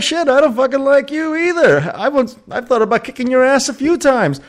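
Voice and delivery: high-pitched retold friend's retort; mock-indignant comeback. Friend firing back at his buddy, high and mock-indignant.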